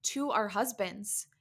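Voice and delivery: normal talking voice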